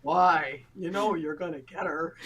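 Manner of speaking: low, resigned imitation of a husband; husband's voice. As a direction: drop deep to mimic a knowing husband. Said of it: "And he said".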